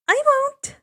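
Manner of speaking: high, reassuring promise; reassuring girl. A narrator's reading. Annabeth assures worried Percy she'll be back. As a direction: promise him it's fine. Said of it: "Annabeth assures".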